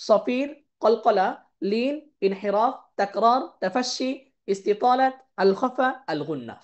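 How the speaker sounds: each name said slowly, one by one; slow, deliberate list of tajweed terms; slow separate recitation. Shown as a demonstration. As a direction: pause between every term as you list them. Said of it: "প্রত্যেকটাকে আলাদা আলাদা পড়বো".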